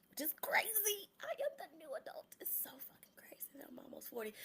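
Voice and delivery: high, breathless disbelief; hushed, incredulous aside about turning forty; incredulous whisper. Real, not acted. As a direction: whisper it like you can't believe it.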